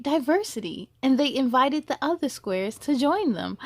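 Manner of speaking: gentle, measured storybook reading; story narration. A narrator's reading. Reading a picture book aloud to young listeners, gentle storytelling. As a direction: read it like a bedtime story to kids.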